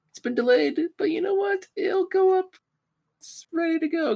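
excited high voice. Real, not acted. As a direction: sell it like a victory. Mock triumphant about delayed episode.

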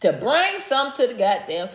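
ordinary talk

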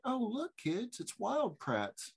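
put-on soft sing-song to the kids, starting high; distracting dad. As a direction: coax the kids with feigned delight, slowing down.

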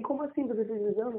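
imitando a mãe assustada, mais aguda. Retold or imitated. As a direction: pergunta espantada em tom alto de mãe. (mãe ouvindo que o filho tem câncer, assustada)

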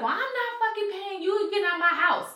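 telling the painter to get out, reenacted high and loud; reenacted furious refusal. Retold fight with painter.

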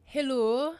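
high, loud Scottish hello; Scottish accent. Shown as a demonstration. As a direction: call out a Scottish greeting.

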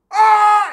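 high, loud drawn-out scream; imitated scream. Friend on acid running off, screaming. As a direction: let out a wild tripping scream. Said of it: "starts screaming at the top of his lungs".

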